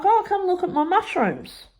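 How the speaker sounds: high, eager sing-song call; excited showing off. Retold or imitated. To mum, high and eager to show off. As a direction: show off the mushrooms proudly. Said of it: "and I'm like".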